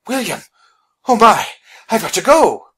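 high, loud, sudden worry; alarmed exclamation. Acted. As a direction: blurt it out in alarm before bolting. Phineas alarmed, bolts for the door.

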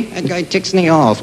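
ordinary delivery

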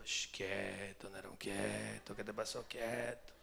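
low, slow calming hush, like settling a dog; soothing hush. Retold or imitated. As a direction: hush him gently and slowly, low. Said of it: "Ya comencé yo". (calmando a un perro con voz baja y lenta)

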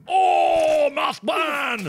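high, drawn-out yell; dramatic cry. Pretend. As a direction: cry out the name in high alarm. Crying out at game death.

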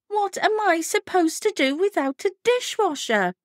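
high, outraged friend voice; indignant friend. Retold or imitated.

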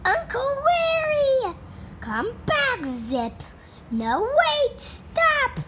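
high desperate call. Acted. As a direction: shout after someone leaving. Calling after departing uncle.

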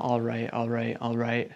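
low, soft catchphrase imitation; Matthew McConaughey. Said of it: "make Matthew McConaughey make a commercial".

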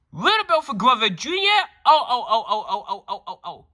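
gasping, high, loud shock fading to a laugh; a dad stunned by the news; shocked father. Acted. Father hears bad news on phone.

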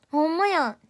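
delighted surprise. Real, not acted. Streamer seeing she climbed the ranking, surprised delight. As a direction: blurt it out in happy surprise.

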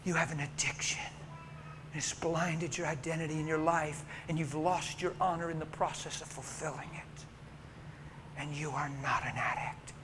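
soft, low, hushed counsel; soft pastoral whisper; soft tender counsel. Retold or imitated. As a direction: gently talk her out of the dark. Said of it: "I said".

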